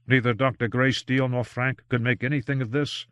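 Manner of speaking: ordinary delivery, nothing marked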